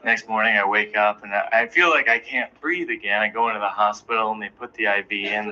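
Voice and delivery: normal talking voice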